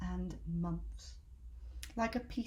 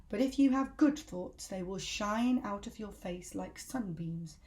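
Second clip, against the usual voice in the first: usual speaking voice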